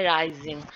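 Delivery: Rising with the stress on राइ; stress on first syllable. Shown as a demonstration. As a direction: put the weight on राइ, not Jing. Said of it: "इसकी जो pitch है वो ज़्यादा है".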